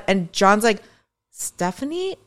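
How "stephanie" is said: a quiet, pointed use of her name; very serious. Retold or imitated. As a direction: say her first name gravely, a touch higher. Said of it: "he uses my first name when he's being very serious".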